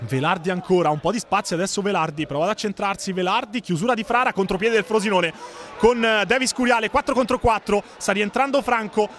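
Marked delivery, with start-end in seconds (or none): none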